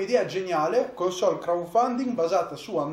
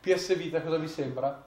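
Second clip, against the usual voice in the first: normal talking voice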